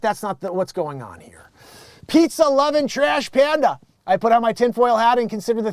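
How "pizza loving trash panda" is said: mock nickname. Nickname joke.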